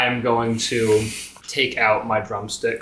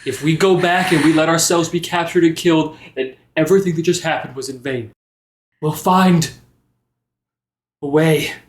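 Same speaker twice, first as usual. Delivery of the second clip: louder, earnest rallying speech; rally the grieving friend; impassioned resolve; 7 semitones higher, 6 dB louder, faster than usual. Acted. Fen urging Jericho not to go back, louder and impassioned.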